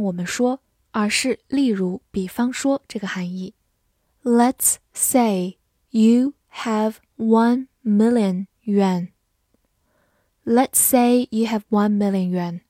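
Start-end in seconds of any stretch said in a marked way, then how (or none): 4.2-9.1 slow, word by word with pauses; slow reading. Shown as a demonstration. Teacher reads the example sentence for learners, slowly. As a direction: read it slowly, each word on its own.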